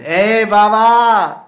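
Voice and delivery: high, drawn-out call at full volume; boys' loud yell. Retold or imitated. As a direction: yell it out long and high to rouse him. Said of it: "they would yell and say".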